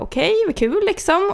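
high, put-on politeness; acted polite phone reply, very high; feigned polite interest. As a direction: pitch it way up, politely noncommittal. Feigned interest in awkward call.

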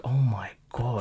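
slow, hushed whisper of shock; awed whisper. Retold or imitated. Driver overhearing an adoption story, stunned whisper. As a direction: breathe it out slowly under your breath.

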